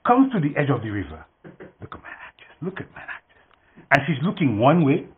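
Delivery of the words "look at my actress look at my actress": hushed aside to the audience; stage whisper. Real, not acted. Stage whisper during kids' play.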